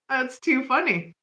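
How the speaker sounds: ordinary talk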